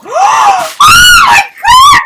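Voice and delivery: shrieking oh my God; excited scream. Real, not acted. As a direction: scream with delight. Gasps opening box.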